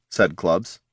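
ordinary delivery

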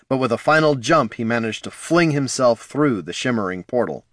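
ordinary delivery, nothing marked

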